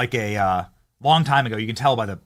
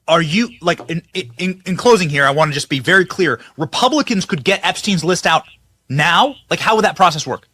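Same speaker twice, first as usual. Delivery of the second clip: loud, pitched-up excited question; 6 semitones higher, 7 dB louder than usual. Real, not acted. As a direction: push it out high and loud. Host asking loud energetic question.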